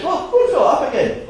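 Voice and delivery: a jolly fisherman chuckling through it. Acted. Fisherman telling the wee girl to refill, laughing. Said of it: "fisherman says".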